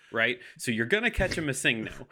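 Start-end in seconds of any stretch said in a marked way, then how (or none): none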